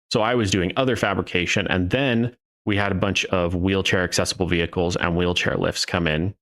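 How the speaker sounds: ordinary talk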